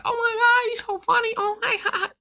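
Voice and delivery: high, breathy gushing fan voice, laughing; squealing fan girl; giggly girl quote. Retold or imitated. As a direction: gush like a starstruck girl at a show. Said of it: "She was like".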